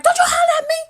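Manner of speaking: very high, loud yell cracking into laughter; hollering back. Retold or imitated. Retelling argument with sister, laughing yell. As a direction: shriek it back, half laughing. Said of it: "I'm hollering back".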